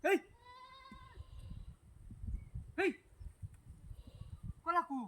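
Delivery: furious yells over the missing bananas; angry shouting. Acted. Owner finds his banana bunch stolen, furious shouting. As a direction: bellow it out, outraged.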